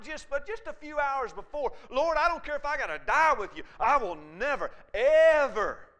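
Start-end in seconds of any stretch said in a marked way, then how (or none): 1.9-5.9 loud, impassioned pledge building to the last word; fervent vow. Acted. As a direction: swear it with full force, punching the final word. Acts out Peter's fervent vow.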